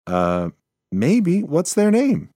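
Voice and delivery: hesitant then curious; unsure mouse. Acted. Mouse asks possum.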